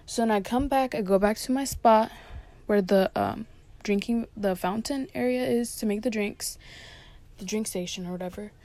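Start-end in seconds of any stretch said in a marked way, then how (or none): none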